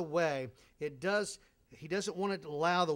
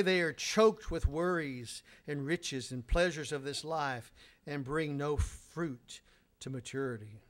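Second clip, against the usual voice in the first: ordinary talk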